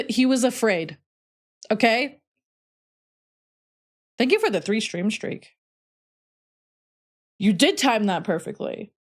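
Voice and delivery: ordinary talk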